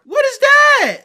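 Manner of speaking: very loud, high-pitched yell; screaming exasperated question; incredulous shout. Real, not acted. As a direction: explode in baffled disbelief. Baffled rant about new slang.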